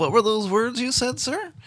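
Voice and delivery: high, puzzled question rising to the end; baffled mock inquiry. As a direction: play a mock-polite listener, voice climbing high on the last word. Acting a baffled listener's question.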